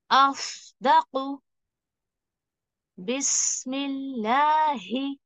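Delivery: very slow recitation with the sound carried through; tajweed demo. Shown as a demonstration. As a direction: draw each word out, letting the voice flow on. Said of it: "तो देखिए किस तरीके से इसको पढ़ना है". (आवाज़ चलाते हुए बहुत धीमी तिलावत)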